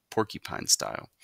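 usual speaking voice

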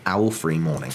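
ordinary delivery

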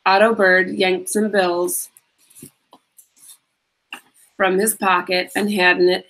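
plain reading voice

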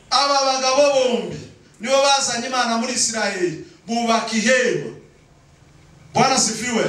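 high-pitched sermon proclamation; impassioned preaching. Real, not acted. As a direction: proclaim it to the congregation. Preaches at full force.

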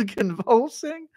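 ordinary talk

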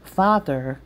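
ordinary delivery

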